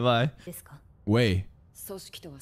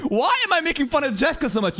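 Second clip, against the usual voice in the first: high-pitched retold exasperation; mock self-questioning; 10 semitones higher than usual. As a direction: ask yourself in mock disbelief, voice high. Quoting self questioning own behaviour.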